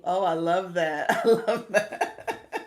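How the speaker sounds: loud, slow words through real laughter; real laugh over the words. Real, not acted. Laughing at a viewer's joke.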